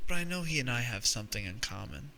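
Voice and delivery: ordinary delivery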